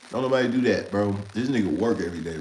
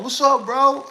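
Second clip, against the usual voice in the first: high, loud hyped-up hello; hyped greeting; 10 dB louder, slower than usual. Retold or imitated. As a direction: grab the phone and greet him big. Drunk brother grabs the phone.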